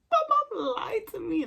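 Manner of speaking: mock crying. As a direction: wail it as if in tears. Mock crying over mom's lie.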